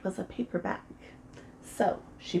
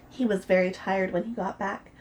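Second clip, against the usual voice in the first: ordinary delivery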